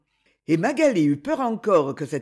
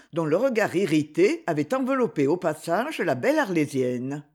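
plain reading voice

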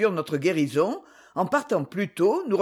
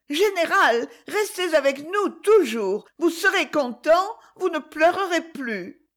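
put-on pleading child; 9 semitones higher than usual. A narrator's reading. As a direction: beg him to stay like a little boy. Child pleads, loud high.

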